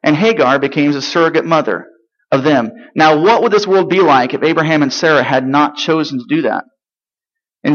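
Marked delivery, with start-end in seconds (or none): none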